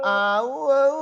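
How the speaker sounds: loud, high "au-au" jungle holler; mock Tarzan cry, shown as wrong; Tarzan yell. Shown as a demonstration.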